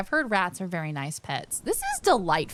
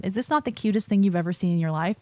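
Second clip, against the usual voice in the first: normal talking voice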